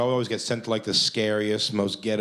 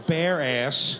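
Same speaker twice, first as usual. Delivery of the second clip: ordinary talk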